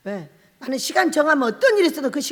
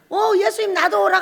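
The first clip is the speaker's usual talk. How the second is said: much higher eager plea; eager Peter; 8 semitones higher, 5 dB louder than usual. Retold or imitated. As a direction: beg to come too, excited. Peter eagerly asking Jesus.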